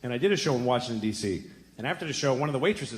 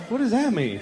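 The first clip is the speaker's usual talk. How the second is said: high, loud bewilderment; can't believe what she just said; baffled shout; 11 semitones higher, 7 dB louder than usual. Retold or imitated. Comedian telling crowd he can't believe her, loud baffled shout. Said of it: "I was like".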